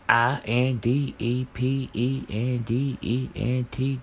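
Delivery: letter by letter, slow and drawn out; spelling it out. Retold or imitated. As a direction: spell every letter slowly like a chant. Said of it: "In the words of Webby".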